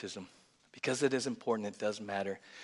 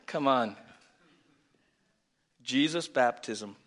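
usual speaking voice

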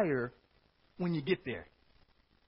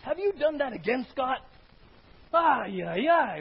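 loud, high scolding yell; angry scolding God; 11 semitones higher, 10 dB louder, slower than usual. Acted. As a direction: scold like a fed-up parent. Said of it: "you can hear it that way".

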